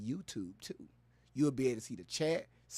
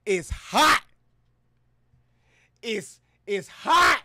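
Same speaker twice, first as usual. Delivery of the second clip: loud, high cry of it's hot; hammed-up heat complaint; 8 semitones higher, 12 dB louder, slower than usual. Streamer joking to viewers about the heat, loud mock wail. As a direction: wail it like the heat is killing you.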